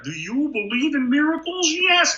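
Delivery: half Ernie, half Fozzie calling; Ernie-Fozzie blend. Retold or imitated. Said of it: "Ernie and Fozzie Bear".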